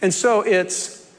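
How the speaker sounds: ordinary talk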